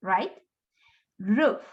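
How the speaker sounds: ordinary talk, nothing marked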